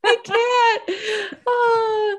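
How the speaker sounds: high, tearful and breathless; helpless with laughter; crying with laughter. Real, not acted. Overcome by laughter.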